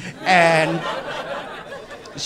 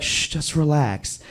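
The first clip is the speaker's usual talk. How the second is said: soothing hush; 5 dB quieter than usual. Retold or imitated. Girlfriend hushing him in retold scene.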